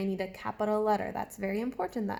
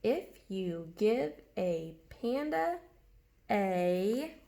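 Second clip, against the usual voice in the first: slow, careful read-back; slow reading aloud; much slower than usual. Shown as a demonstration. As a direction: read it back slowly, word by word. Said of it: "read back and make sure".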